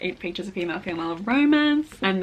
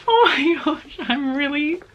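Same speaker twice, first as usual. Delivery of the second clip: high, loud, crying with joy; teary happiness; 6 semitones higher, 3 dB louder than usual. Real, not acted. Vlogger moved by the author's note, tearful joy. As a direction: voice cracking with happy tears. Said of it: "I'm so happy right now".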